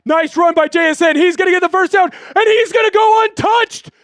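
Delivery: excited shout. Real, not acted. Streamer yelling a touchdown call to viewers, excited shout. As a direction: get carried away calling a touchdown. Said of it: "I got excited".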